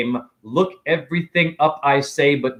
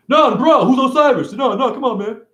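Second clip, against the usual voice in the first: higher, louder dumb-bro voice; mock anarchist bro; 10 semitones higher, 4 dB louder than usual. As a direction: play the clueless anarchist. Mocking an anarchist's reply.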